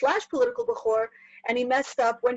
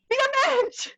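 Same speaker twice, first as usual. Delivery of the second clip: high, exasperated plea; acted high-pitched scolding; exhorting cry; 13 semitones higher than usual. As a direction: cry it out like a fed-up parent.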